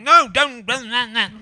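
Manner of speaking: hammed-up denial in a put-on voice; mocking Michael Gove. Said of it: "so he was on Newsnight last night going".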